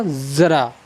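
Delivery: ज़रा said as zara with a z; ज़रा with the Urdu z sound; z in ज़रा, not j; nukta pronunciation. Shown as a demonstration. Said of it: "ये क्या है हमारा?".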